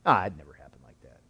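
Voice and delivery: dismissive skeptic. Retold or imitated. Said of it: "is they can say".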